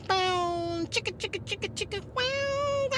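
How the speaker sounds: soft, high bow chicka wow wow; breathy mock porn-music riff; porn groove riff. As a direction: hum a cheesy bedroom bassline.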